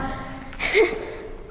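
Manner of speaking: a short airy giggle under the breath; quiet unvoiced chuckle; soft breathy laugh. Real, not acted. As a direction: let a little embarrassed laugh slip out. Embarrassed giggle after awkward answer.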